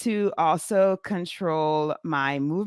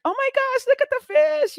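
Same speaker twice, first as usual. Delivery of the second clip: much higher, squealing with delight at a fish; high, loud burst of wonder; excited gasp; 4 dB louder than usual. Retold or imitated. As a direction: gush over the fishy like a thrilled kid. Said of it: "And I'm like".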